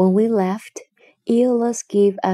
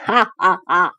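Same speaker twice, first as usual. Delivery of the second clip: villain laugh; 4 dB louder than usual. Acted. Cyclops laughing after threat.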